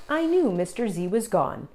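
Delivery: usual speaking voice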